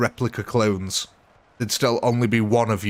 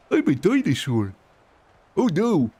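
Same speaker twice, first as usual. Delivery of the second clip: Barney impression; 6 semitones higher than usual. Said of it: "is that Barney?".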